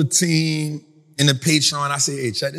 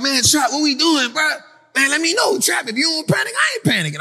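high, shouted plea; mock panicked investor; 10 semitones higher than usual. Follower begging Trap for answers, in a high mock shout. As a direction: yell it like a nervous follower.

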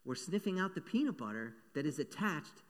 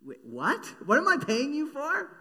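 sudden outraged question, pitch jumping much higher and louder; shocked client demanding an answer; indignant disbelief; 12 semitones higher, 9 dB louder than usual. Acted. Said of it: "And you'd be like".

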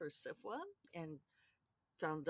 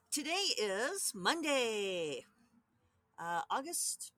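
slow sing-song announcing; drawn-out announcement; 5 semitones higher, 6 dB louder, much slower than usual. Acted. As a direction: stretch it out like a host.

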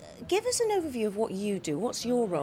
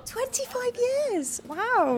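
amazed; 14 semitones higher, much slower than usual. Real, not acted. Astonished at long service.

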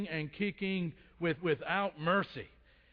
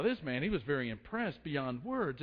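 normal talking voice

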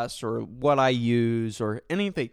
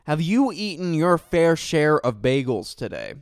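louder and more insistent; stronger; 4 semitones higher, 6 dB louder than usual. Pretend. Repeating the bagel question to a confused stranger, louder. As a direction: press the question harder.

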